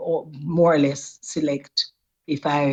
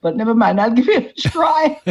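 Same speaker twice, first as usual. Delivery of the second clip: mock excited; 4 semitones higher, 4 dB louder than usual. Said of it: "I said".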